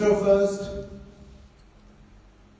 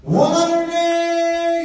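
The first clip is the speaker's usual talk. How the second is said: slow, sung, higher, louder call; bellowed one eighty; 9 semitones higher, 5 dB louder, much slower than usual. Real, not acted. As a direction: stretch out the 180 call like a darts caller. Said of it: "bellowing one hundred and eighty".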